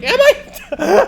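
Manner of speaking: high laughing startled question; high laughing denial; surprised squeak. Retold or imitated. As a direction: caught off guard, squeaky. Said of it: "And I'm like".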